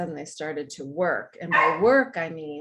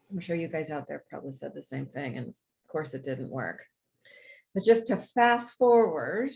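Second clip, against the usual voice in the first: normal talking voice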